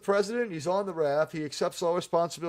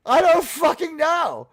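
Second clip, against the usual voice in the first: louder, higher, slower, exasperated; exasperated shout; 11 semitones higher, 8 dB louder, slower than usual. Real, not acted. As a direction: throw it out in disbelief. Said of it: "exasperated rant repeated louder".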